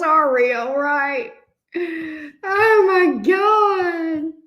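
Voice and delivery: high, helpless wail through laughter; laughing to tears. Real, not acted. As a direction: gasp out the words, nearly crying with laughter. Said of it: "laugh till I cry".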